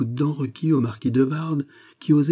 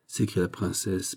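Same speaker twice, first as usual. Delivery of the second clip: ordinary delivery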